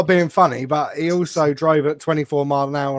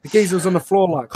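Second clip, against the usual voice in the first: ordinary talk, nothing marked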